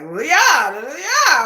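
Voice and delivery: "Yeah, yeah" swooping up high and loud; rising Busan intonation. Retold or imitated. Showing how people in Busan talk, exaggerated high swoop on "yeah". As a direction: imitate Busan speech, pushing each "yeah" sharply upward. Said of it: "like, it goes up".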